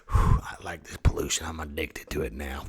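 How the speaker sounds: low, breath-heavy, raspy Mother Earth; quiet, croaky confession; Nick Nolte. Acted. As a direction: sigh it out like a hooked smoker. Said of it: "Does Mother Earth sound like Nick Nolte?".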